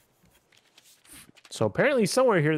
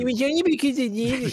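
mocking imitation; 13 semitones higher, 5 dB louder than usual. Mocking friend's excuse back.

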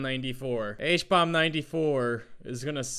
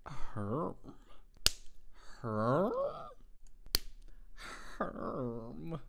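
villager grunt; 8 dB quieter, much slower than usual. Acted. Masochist villager right here.

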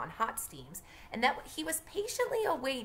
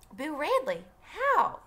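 surprised question; 8 semitones higher, 5 dB louder, slower than usual. Acted. Dill asks eagerly.

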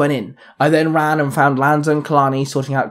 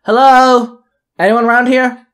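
high, loud call into the wreck; yelling; 8 semitones higher, 6 dB louder than usual. A narrator's reading. Narrator yelling into the dark train. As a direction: call out to anyone nearby. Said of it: "I yelled".

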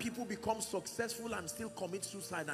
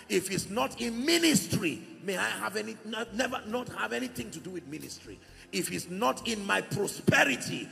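forceful preaching, much louder; loud harsh voice; 3 semitones higher, 9 dB louder than usual. Real, not acted. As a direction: thunder it from the pulpit. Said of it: "I apologize if I sound harsh".

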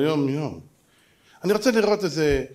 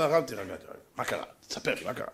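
softer, tender reassurance; gentle soothing; 7 semitones lower, 10 dB quieter than usual. Retold or imitated. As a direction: hush him kindly. Rabbi soothing the youth.

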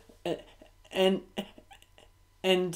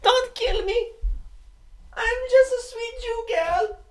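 very high, whimpering put-on girl's voice; Anne Frank impression; 13 semitones higher, 7 dB louder than usual. Girl begging for her life, high mock-crying plea. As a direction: plead in a hammed-up, tearful squeal. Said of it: "that was my impression of Anne Frank".

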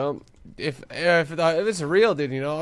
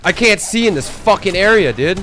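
frustrated shout; 5 semitones higher, 10 dB louder than usual. Real, not acted. Frustrated, can't see in game.

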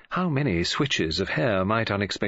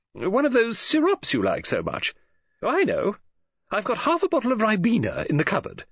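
bright, higher-pitched woman's voice; Mrs Oliver; 12 semitones higher than usual. A narrator's reading. Novelist greeting Poirot on the phone, bright high voice. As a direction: play the chatty lady novelist, lifted and eager.

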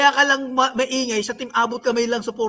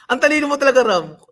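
usual speaking voice

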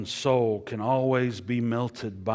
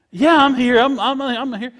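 much higher and louder, a mock flustered voice; distracted phone listener; 15 dB louder than usual. Caught not listening on the phone, mock flustered and high. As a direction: play the caught-out friend on the line.

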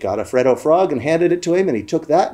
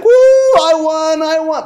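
high, loud whoop of victory; gleeful celebrating; 7 dB louder, much slower than usual. Retold or imitated. Boy who would gloat after winning, high whooping cheer. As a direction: imitate an excited kid cheering. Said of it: "he would be like".

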